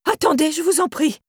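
high, pleading cry. Acted. Irène begs Théo to give back the bag, pleading high.

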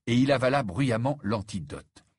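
ordinary delivery

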